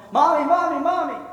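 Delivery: high, loud, urgent calls for mom; excited child calling. Acted. Little girl comes home to her mother, calling excitedly.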